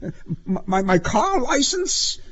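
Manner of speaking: very high, stammering question; timid stutter before the cop; trembling, frightened query; shaking. A narrator's reading.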